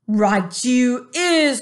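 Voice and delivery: loud, slow, menacing giant voice; booming giant. A narrator's reading. Bully giant mocking the BFG, loud and slow. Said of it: "cried the blood bottler".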